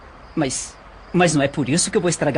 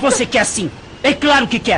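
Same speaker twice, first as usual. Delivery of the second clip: forceful insistence; 6 semitones higher, 3 dB louder than usual. Acted. Man insisting during the struggle.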